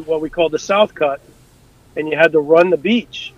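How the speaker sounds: usual speaking voice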